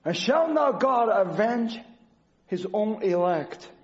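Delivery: slow, weighty Bible reading; reading the verse to the congregation, slow and solemn; scripture reading. Acted. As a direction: read each phrase slowly and solemnly. Reads scripture slowly.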